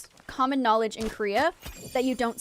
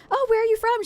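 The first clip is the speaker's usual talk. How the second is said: much higher, perky put-on question; chirpy stranger; 11 semitones higher, 4 dB louder than usual. Guy approaching a girl hiking, mock chirpy. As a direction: imitate an over-friendly passerby.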